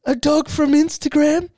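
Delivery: high, pitiful retold admission; mock crying. Husband confessing to his missus, mock crying. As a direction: squeak it out as if tearful. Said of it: "She sees me crying".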